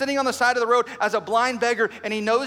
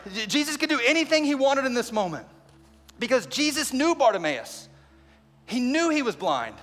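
usual speaking voice

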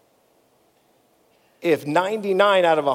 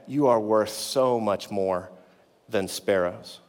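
softer and lower, slowing to a near hush; tender reassurance; 7 semitones lower, 5 dB quieter than usual. Acted. Preacher voicing God, reassuring the listener, tender and hushed. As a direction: let it land gently, like a promise.

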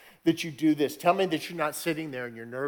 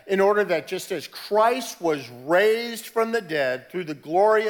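a touch higher and louder, read as scripture; 3 semitones higher, 5 dB louder than usual. Acted. Pastor reading Romans to the congregation, mildly elevated. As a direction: lift Romans six off the page with some weight. Reads Romans six aloud.

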